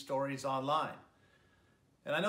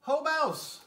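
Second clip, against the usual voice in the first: much higher, bright hare voice calling out; hare's greeting; 13 semitones higher, 8 dB louder than usual. Acted. Hare bursting in the door, calling out high and loud. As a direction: hail the mouse like a jumpy hare. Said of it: "says the hare".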